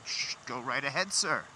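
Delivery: mock-polite call to a driver; sarcastic. Real, not acted.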